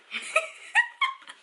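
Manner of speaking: squealing laugh; high burst of laughter. Real, not acted. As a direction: let the laugh break out high. Laughs at own impression.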